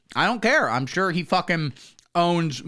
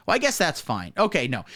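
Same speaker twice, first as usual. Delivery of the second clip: ordinary delivery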